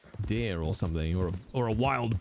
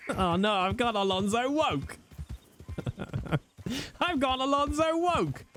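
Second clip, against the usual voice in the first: mock alarm; 12 semitones higher than usual. Excited high mock alarm.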